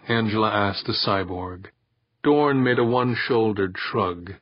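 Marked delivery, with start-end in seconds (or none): none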